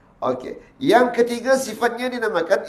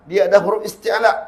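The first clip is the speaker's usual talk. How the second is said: ordinary delivery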